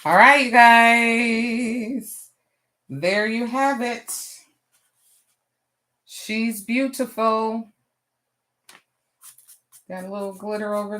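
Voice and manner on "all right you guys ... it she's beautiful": slow, loud drawn-out reveal; showing off the result; proud reveal. Real, not acted. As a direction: present the finished piece proudly. Proud reveal of finished piece.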